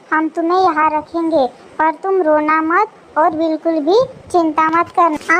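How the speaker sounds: ordinary talk, nothing marked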